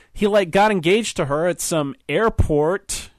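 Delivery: put-on husband needling his wife's old fiancé, slurring drunkenly; drunken slur. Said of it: "said in a slurred accent".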